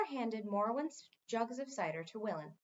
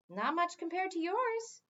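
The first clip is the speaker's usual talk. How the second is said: cheerful; 7 semitones higher than usual. A narrator's reading. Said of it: "said Mendenbar cheerfully".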